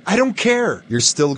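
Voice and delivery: sharp, cold dismissal; stern refusal. Acted. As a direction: shut him down hard. Cop refuses Caillou's plea.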